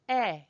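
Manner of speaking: short "Eh" with a breathy h-like end. Shown as a demonstration. Teacher correcting the Brooklyn version, short breathy "Eh". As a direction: say "Eh" short, no glide. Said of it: "it's a breathy E H almost".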